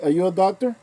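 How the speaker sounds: loud, heated telling-off; angry retort. Pretend. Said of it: "if I was my dad, I would have said".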